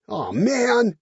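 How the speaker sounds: high, loud drawn-out whine; whining. Acted. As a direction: whine like a let-down drunk. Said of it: "the accountant whined".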